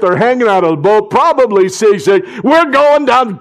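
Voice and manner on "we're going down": high, loud panicked cry; Thomas in despair. Acted. Thomas cries to the others in the sinking boat. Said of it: "Thomas must have been saying".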